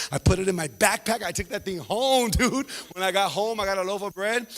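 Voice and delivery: usual speaking voice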